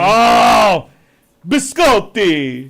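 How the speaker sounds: biscotti drawn out slow with a loud Italian flourish; mock Italian biscotti. Friends mocking fancy foreign words, loud drawn-out Italian biscotti. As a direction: roll out biscotti like a hammy Italian.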